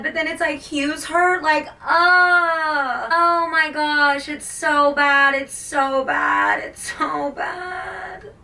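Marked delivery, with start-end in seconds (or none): 1.7-8.3 slow, drawn-out wail, climbing at the end; upset, whining sobby delivery; tearful distress. Real, not acted. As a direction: cry it out, stretching each phrase. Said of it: "it's just so sad".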